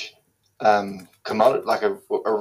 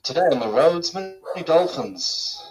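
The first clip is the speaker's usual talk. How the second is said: higher-pitched mock traffic bulletin, slowing down; hammed-up, higher radio reporter; Venetian traffic reporter; 5 semitones higher than usual. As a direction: announce it like a silly Venice traffic report. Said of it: "What would a Venetian traffic report be like?".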